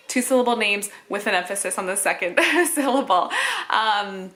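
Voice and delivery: ordinary talk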